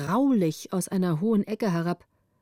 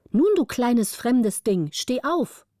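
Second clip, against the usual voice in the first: put-on playful bossy girl; 4 semitones higher, slower than usual. The orphan tells the new girl to get up, in mock command. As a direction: order the newcomer up in fun.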